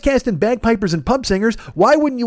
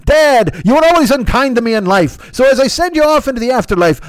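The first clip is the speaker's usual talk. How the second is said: raised, hammed-up Irish lilt; mock eulogy in a broad Irish accent; 3 semitones higher than usual. Son sending his unkind dad off into the afterlife, mock Irish accent. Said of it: "this accent the whole fucking time".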